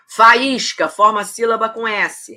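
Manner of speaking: ordinary talk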